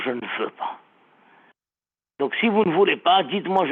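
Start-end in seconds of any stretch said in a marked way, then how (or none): 0.0-0.8 "je ne veux pas" low and soft; low quiet "veux"; soft low right phrase. Shown as a demonstration. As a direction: softly give the right phrase. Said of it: "Il faut dire".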